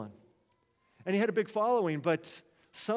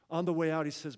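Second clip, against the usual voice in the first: normal talking voice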